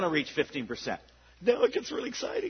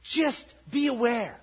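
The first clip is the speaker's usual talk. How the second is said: preaching at full force; 2 semitones higher, 7 dB louder than usual. Real, not acted. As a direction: send them out with fire. Closing charge to congregation.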